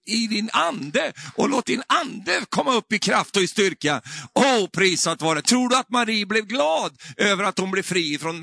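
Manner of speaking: high, loud sermon delivery; exhorting preacher at full force; fervent preaching. Real, not acted.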